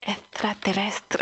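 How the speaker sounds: "Extraterrestre" said slowly in a mock Spanish accent, rolled and stretched. Host tries the Spanish film title, mock Spanish accent. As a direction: give "Extraterrestre" a hammed-up Spanish accent, drawn out. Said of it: "j'ai essayé de le dire en espagnol".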